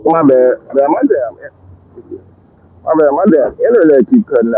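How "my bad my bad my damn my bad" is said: normal talking voice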